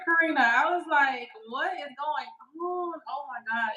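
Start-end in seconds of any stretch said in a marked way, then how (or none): 1.5-3.7 slow, hushed retold dread; acting out frightened disbelief; scared. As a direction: drag it out in soft alarm. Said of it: "I was so scared".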